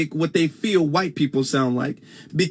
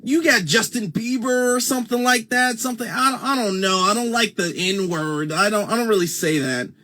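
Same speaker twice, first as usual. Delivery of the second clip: higher-pitched, put-on proper diction, mildly whiny; 4 semitones higher than usual. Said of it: "a lot of times they'll say something like".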